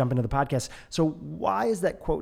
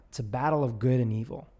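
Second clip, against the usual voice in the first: normal talking voice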